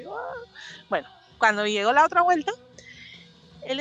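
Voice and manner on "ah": high, soft drawn "Ah" of anguish; anguished sigh. Retold or imitated. Caller waiting an hour on hold, high soft anguished sigh. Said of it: "esa angustia".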